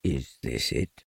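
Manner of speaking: low, soft, slow donkey voice; understated surprise, kept low and slow; Eeyore, a little surprised. A narrator's reading. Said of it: "a little surprised".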